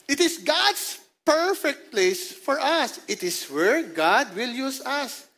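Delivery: very slow, weighted repetition of the verse; slow, deliberate scripture recital; slow emphatic reading. Acted. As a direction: stretch out each word. Repeating the verse slowly.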